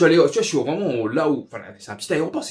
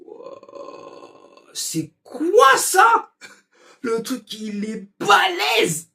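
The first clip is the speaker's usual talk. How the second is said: émerveillement crié; 10 semitones higher, 4 dB louder, much slower than usual. Retold or imitated. Émerveillé devant le frigo américain.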